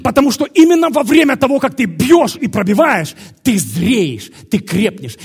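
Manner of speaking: loud and high, easing toward the end; forceful preaching. Real, not acted. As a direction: press each phrase on the crowd, loudly. Preacher urges the congregation.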